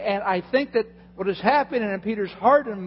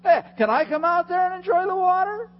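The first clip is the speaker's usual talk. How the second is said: excited Peter; 13 semitones higher, 8 dB louder than usual. Retold or imitated. Preacher voicing an eager Peter to the crowd. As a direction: act out Peter begging to come out. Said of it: "What would possess him to say".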